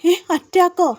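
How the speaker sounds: retold shock, high and loud; shocked question. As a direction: react to frightening news. Daughter hears of heart attack.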